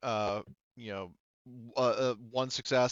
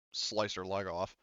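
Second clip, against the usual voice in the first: ordinary delivery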